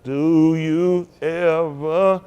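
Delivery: drawn-out, very slow dreamy mock voice; dreamy daydreamer voice. Mocking daydreaming congregants with slow dreamy voice. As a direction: stretch each word like someone lost in a daydream. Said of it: "They be doing it".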